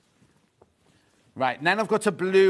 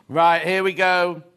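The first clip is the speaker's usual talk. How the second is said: slow, louder announcing; building excitement; 8 dB louder, slower than usual. Acted. Unveiling the next fabric to viewers, slow hyped announcing. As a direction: hype the reveal, drawn out.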